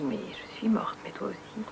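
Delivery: chuchoté, presque sans voix; murmure de la marraine. Retold or imitated. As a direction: souffle les mots comme une morte apaisée. La marraine morte lui répond. (la marraine défunte lui parle, en chuchotant)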